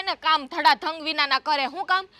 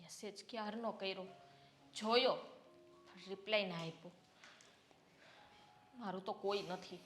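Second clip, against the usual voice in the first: much softer, lower, sorrowful murmur; hurt and lonely; 8 semitones lower, 15 dB quieter than usual. Acted. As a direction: grieve quietly to herself. Wife alone, hurt and lonely.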